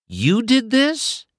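high, slow stunned question; can't believe what he did; Sally confronts Doc, stunned; shocked disbelief. Acted.

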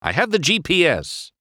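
insistent, high-pitched boast; stubborn. A narrator's reading. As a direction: dig in your heels. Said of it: "said stubbornly".

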